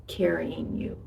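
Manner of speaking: soft breathy whisper of comfort. Acted. God tells you he's carrying you, whispered. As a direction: breathe the words out, no voice. Quoting God's tender reassurance.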